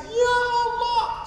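very high, drawn-out mock wail; imitated group weeping. Pilgrims crying on seeing the Kaaba, imitated weeping. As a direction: wail it slowly like a tearful pilgrim, hammed up. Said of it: "satu rombongan nangis".

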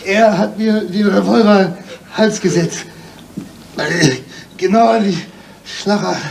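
afraid. Acted. Hostage with gun at neck.

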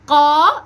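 قا loud, long and heavy, from the back of the tongue; qaf sound drill. Shown as a demonstration. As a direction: model a forceful, sustained قا. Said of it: "أول حاجة هننطقها الصوت". (تدريب على القاف المفخمة)

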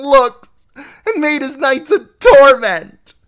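a sister ranting about Catelyn; venomous aunt. Acted. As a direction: spit out jealousy. Lysa ranting, rising.

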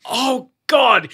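mock dread. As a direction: groan it high in mock despair. Acts out dread at task.

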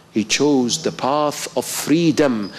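ordinary talk

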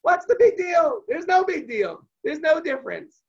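pitched high and loud, slow and scoffing; dismissive rhetorical shrug. Acted. Teacher voicing a dismissive Manishtana, high and loud. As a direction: brush the whole question off as no big deal. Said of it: "as a statement of".

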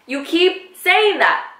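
high, slow retold complaint; exasperated and drawn out, pitched high. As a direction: stretch the words out in frustration. Retold exasperation, repeats herself.

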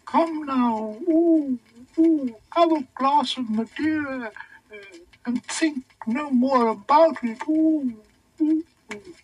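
high, soothing cooing creature; fussing animal voice, high and cooing. A narrator's reading. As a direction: coo over the upset king, very high.

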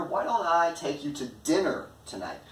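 "dinner" punched above the other words; stress on DINNER. Shown as a demonstration. Proposing dinner instead of lunch, stress on "dinner". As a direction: lean hard on the word "dinner". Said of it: "The focus here is on dinner".

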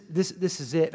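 ordinary talk, nothing marked

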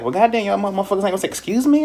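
high, fast exasperated outburst; mock-indignant rant at customers who cut in front; indignant retort. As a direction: fire it off like you can't believe the rudeness. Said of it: "I'm like".

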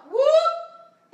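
whoop. Real, not acted. Effort cry during squats.

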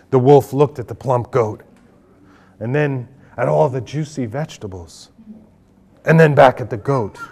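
ordinary delivery

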